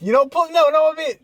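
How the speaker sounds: alarmed protest. Real, not acted. Refusing beard waxing.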